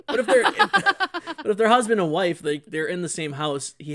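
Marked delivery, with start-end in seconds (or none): 0.1-2.4 laughing. Real, not acted. Laughing high through the words.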